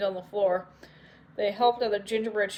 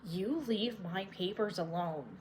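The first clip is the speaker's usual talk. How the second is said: much softer and slow; quiet threat; 10 dB quieter than usual. Acted. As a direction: lay down the warning in a hush. Witch warning the children.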